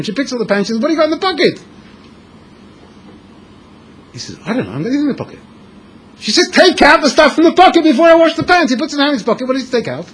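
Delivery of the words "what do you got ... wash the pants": high wife voice, a touch impatient; lightly voiced wife. Wife checking her husband's pockets before washing, high impatient. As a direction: ask like a busy wife doing laundry.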